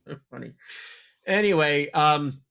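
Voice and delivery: usual speaking voice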